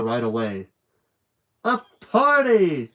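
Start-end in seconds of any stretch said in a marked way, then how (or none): 1.6-2.9 high, loud, drawn-out cry of joy; a thrilled librarian clapping her hands, squealing with joy; delighted exclamation. A narrator's reading. Miss Franny excited, clapping hands.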